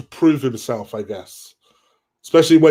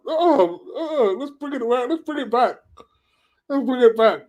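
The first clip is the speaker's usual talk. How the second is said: mock panicky voice; 10 semitones higher than usual. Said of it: "I was like".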